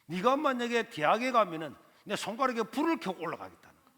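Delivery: 높고 큰 소리로 비웃는 삼촌; 말도 안 된다는 듯 크게 조롱하는 말투; mocking uncle. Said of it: "나를 보면서 하는 말이". (조카의 신학대학 진학을 비웃는 삼촌, 크게 조롱하듯)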